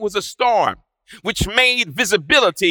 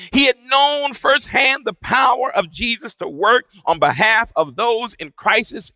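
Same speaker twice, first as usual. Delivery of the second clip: normal talking voice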